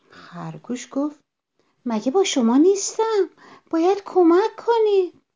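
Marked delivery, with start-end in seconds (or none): 1.8-5.2 high, loud demand; indignant shout. A narrator's reading. Ignored rabbit demanding help from friends, shouting. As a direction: shout over the squabble to be heard.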